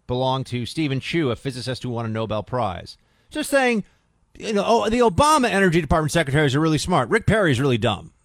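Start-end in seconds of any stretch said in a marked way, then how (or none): 3.3-8.1 loud sarcastic voicing of the article; hammed-up snide jab; mocking paraphrase. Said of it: "That's what they're saying".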